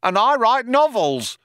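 high, put-on cop voice calling out; second cop chiming in. A narrator's reading. Other cop chimes in to boast, calling out high. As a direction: pipe up proudly at high pitch. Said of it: "chimed in the other cop".

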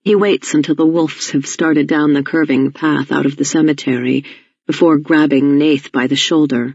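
ordinary delivery

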